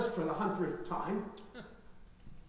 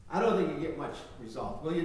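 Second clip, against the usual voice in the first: normal talking voice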